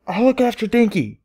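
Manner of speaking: very high, playful filly voice; teasing. A narrator's reading. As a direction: tease her mother from behind the door. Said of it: "her tone almost teasing".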